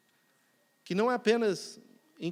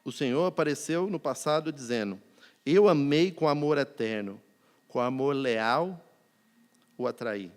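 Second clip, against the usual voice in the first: scripture reading; 4 semitones lower, 3 dB louder than usual. Acted. Reads Jeremiah 31:3.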